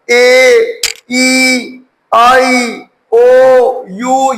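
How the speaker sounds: ए, ई, आई, ओ, यू each held as its letter name; full long vowels. Shown as a demonstration. Teacher giving the vowels' real sounds, ए, ई, आई, ओ, यू in full long form. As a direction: sound out ए, ई, आई, ओ, यू one by one, drawn out, with pauses. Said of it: "इनका असल जो sound है".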